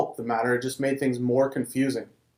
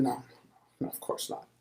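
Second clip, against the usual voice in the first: hushed, breathless no under his breath; whispered denial; 12 dB quieter than usual. A narrator's reading. Peter whispering his denial to the servant girl. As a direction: whisper it so no one hears.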